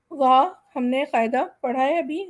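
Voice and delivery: ordinary talk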